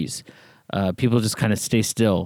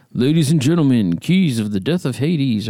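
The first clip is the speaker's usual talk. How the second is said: heavy metal announcer; 3 semitones higher than usual. Retold or imitated. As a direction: announce it like the band is taking the stage. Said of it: "kinda like a heavy metal band or something".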